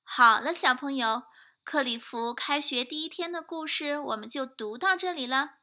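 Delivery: plain reading voice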